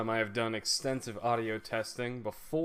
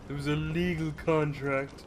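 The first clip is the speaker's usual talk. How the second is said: character voice; 5 semitones higher, 5 dB louder than usual. Acted. Voicing the lady's dialogue reply.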